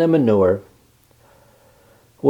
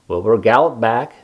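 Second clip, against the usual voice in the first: ordinary delivery, nothing marked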